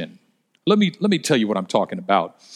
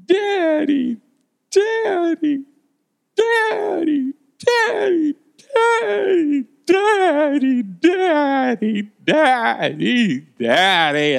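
imitated crying child; much slower than usual. Father mimics small daughter crying on the phone, imitated wail. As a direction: mimic a little girl bawling. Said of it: "she bursts into tears".

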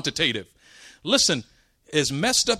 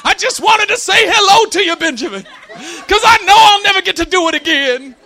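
loud, very high comic wailing; mock crying; 12 semitones higher, 8 dB louder than usual. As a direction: imitate a great-uncle bawling his farewell. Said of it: "He come up crying again".

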